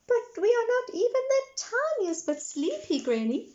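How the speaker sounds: put-on high, loud little girl voice; a small girl insisting she's not tired; protesting Peppa. A narrator's reading.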